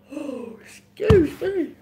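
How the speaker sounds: slow, loud yawn through the words; big real yawn apology. Real, not acted. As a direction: let the yawn swallow it. Yawning mid-sentence.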